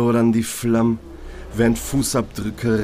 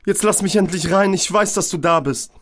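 high pleading yell, more despair than rage; angry and desperate; 9 semitones higher than usual. Acted. Eileen begs Georg to let her in, high desperate yell. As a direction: beg him through the door, furious but scared. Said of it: "Wut und Verzweiflung".

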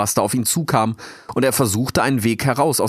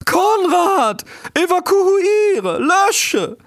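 very high, loud, hooting shout; owl calling out; 5 dB louder, much slower than usual. A narrator's reading. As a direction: screech urgent orders with long hooted vowels. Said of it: "rief ein letztes Mal die Eule". (laut kreischender Eulenruf)

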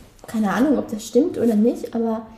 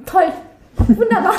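sarcastic wunderbar; 6 semitones higher, 6 dB louder than usual. Real, not acted. Sarcastic after missing the casting.

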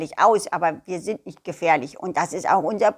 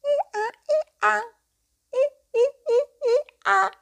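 high, fast squeaky bray; squeaky hee-haw; faster than usual. Acted. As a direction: squeak the I A in quick bursts. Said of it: "Er quietschte und übte". (hohes, schnelles quietschendes I A)

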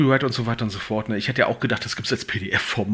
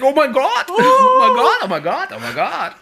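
squealing, much higher, laughing, louder; shrieking jackpot winner; 8 dB louder than usual. Retold or imitated. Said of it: "Ich krieg einen Kopf und denk".

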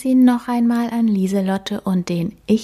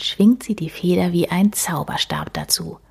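ordinary delivery, nothing marked

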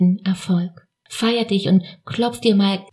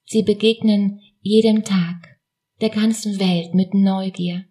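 usual speaking voice

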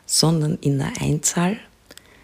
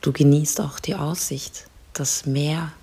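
soft spa whisper; 5 dB quieter than usual. Acted. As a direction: whisper calmly, guide them to relax. Leads a guided relaxation.

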